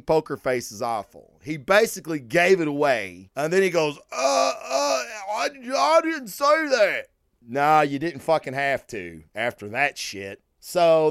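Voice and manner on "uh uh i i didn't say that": high, flustered stammer of a man caught out; flustered stammering denial. Retold or imitated. The artist, cornered by the host, in a flustered stammering denial. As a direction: stammer it like you've just been caught.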